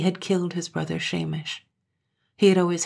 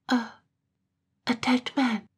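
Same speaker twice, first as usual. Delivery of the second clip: higher, stammering in dread; shocked stammer; 7 semitones higher than usual. A narrator's reading. Katrine stunned to hear her lover is dead. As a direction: stumble over the words in horror.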